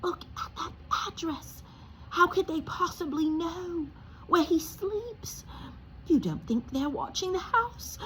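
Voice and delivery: high, trembling frightened whisper; quivering voice. Acted. Aunt Petunia to her husband, a high trembling whisper. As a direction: fear shaking every word. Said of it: "in a quivering voice".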